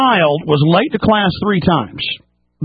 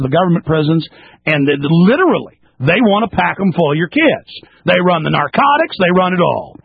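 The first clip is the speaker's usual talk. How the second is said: ordinary talk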